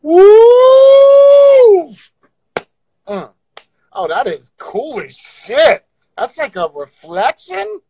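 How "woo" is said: excited whoop. Real, not acted.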